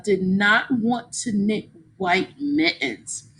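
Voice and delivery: plain reading voice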